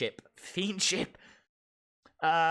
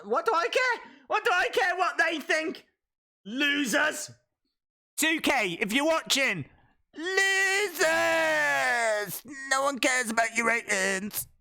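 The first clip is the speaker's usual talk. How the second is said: mock taunt; 8 semitones higher than usual. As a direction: taunt the developers at full volume.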